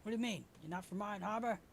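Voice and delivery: high-pitched incredulous questions; acted puzzled surprise; taken-aback sailor. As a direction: question them in disbelief, pitch up.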